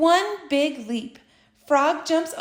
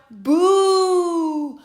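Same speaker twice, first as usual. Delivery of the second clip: loud long jeering boos; crowd booing; 4 semitones higher, 3 dB louder, much slower than usual. A narrator's reading. The animals booing Frog off the stage, jeering. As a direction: boo him off the stage.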